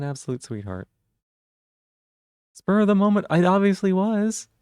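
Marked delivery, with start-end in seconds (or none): 2.5-4.5 excited. Real, not acted. Excited reply to chat.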